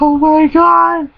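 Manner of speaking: high, drawn-out exclamation; hysterical disbelief. Retold or imitated. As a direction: cry out high and slow, cracking up. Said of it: "started laughing my ass off".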